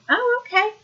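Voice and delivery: retold unfazed reply, starting high; very calm. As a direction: play it deliberately casual, high lilt on the opening. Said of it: "I very calmly said".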